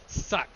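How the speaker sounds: sharp unvoiced suck noise; voiceless throat suck like the parrot; parrot suck imitation. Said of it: "the parrot going".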